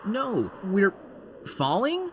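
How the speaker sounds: shooting up high in alarm; alarmed question. Acted. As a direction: squeak it out in disbelief. Realising they are falling.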